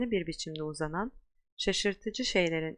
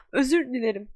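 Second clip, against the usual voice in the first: high, louder apology through sobs; sobbing; 6 semitones higher, 6 dB louder than usual. Acted. Hagrid apologises to McGonagall, sobbing loudly. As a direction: apologise while blubbering. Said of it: "diye hıçkırdı".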